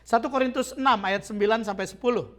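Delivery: normal talking voice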